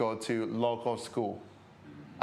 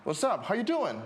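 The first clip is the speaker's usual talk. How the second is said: higher-pitched retold American greeting; drummer's casual greeting; 8 semitones higher than usual. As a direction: toss out a lightly imitated hey-there greeting. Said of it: "he came to me and then he said".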